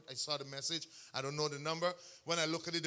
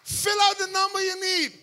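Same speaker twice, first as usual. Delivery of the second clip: "Fill out the number you need" repeated higher and louder, with "out" pushed hard; preached emphasis; 9 semitones higher, 7 dB louder than usual. Acted. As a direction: proclaim it to the congregation, with force.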